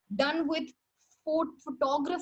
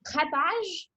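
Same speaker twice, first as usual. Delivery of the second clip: French accent; 3 semitones higher, much slower than usual. Shown as a demonstration. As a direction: say grattage with a French accent, lingering on it. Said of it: "I'm doing it in a French accent".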